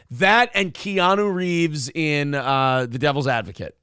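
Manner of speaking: ordinary talk, nothing marked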